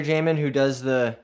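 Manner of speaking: normal talking voice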